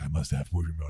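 exaggerated low "must have it" voice; put-on wanting voice. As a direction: drop way down into a silly deep voice. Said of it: "I'll say it in that voice".

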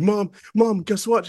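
can't hold in the news; bursting with news. Retold or imitated. Calling mom with news.

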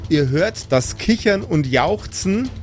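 ordinary delivery

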